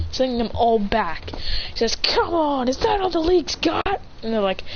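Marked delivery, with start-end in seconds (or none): 2.0-4.0 higher, goading challenge; pitched-up battle taunt; taunting hero. Acted. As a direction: goad the villains in acted bravado. Acted taunt at villains.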